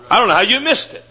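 "missed" lifted high, tune rising at the end. Shown as a demonstration. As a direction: say it again up high, incredulous. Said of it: "I don't know how you missed it here".

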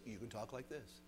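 low and soft on "you can talk like this"; preacher showing the boys how a man talks, low and soft; demo line, dropping low. Shown as a demonstration. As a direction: bring it down to show it. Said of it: "if I can talk like this".

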